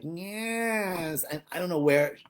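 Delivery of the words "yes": imitating neighbor. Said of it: "she has this voice like".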